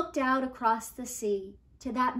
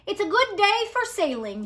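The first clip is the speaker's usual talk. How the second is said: grandfather's voice; 8 semitones higher, 6 dB louder than usual. A narrator's reading. Remembered grandfather line.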